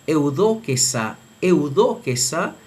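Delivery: "eudoquesa" with eu diphthong, eh-oo-do-ke-SA; Erasmian reading. Shown as a demonstration. Teacher reads a verb in Erasmian, "eudoquesa" starting eh-oo. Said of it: "en la pronunciación erasmiana se lee".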